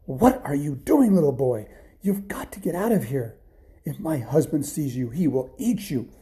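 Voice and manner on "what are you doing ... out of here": high, loud, startled challenge easing into urgent warning; alarmed wife. Acted. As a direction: catch an intruding child in alarm. Giant's wife alarmed at finding boy.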